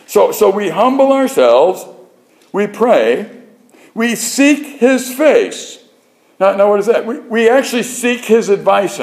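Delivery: high-pitched, insistent sermon delivery; forceful preaching. Real, not acted. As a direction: drive each point home from the pulpit, pitched well up.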